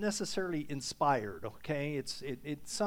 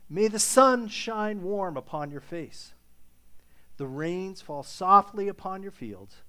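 blessing read aloud; 4 dB louder than usual. Acted. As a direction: let the blessing settle over them. Reads the Irish blessing.

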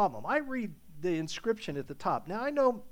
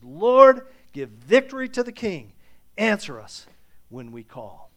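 loud cry of Lord, then pleading; fervent prayer; 12 dB louder than usual. Acted. Preacher voicing David's cry to God, loud and fervent. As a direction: call out to God for the king.

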